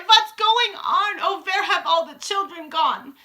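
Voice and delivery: teachers wailing in confusion; crying out. A narrator's reading. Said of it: "The teachers cry".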